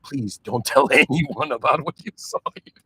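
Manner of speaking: embarrassed plea dissolving into giggles; laughing mock plea. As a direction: beg them jokingly while giggling. Laughing through an imagined plea.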